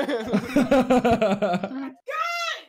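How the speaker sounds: loud burst of laughter; loud shouted laugh. Real, not acted.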